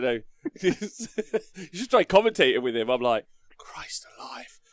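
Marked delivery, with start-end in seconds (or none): 3.6-4.6 slow, voiceless mock awe; whispered amazement. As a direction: whisper it slowly, as if stunned. Acted awe at co-commentator's fast talk.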